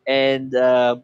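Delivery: ordinary talk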